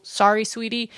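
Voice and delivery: normal talking voice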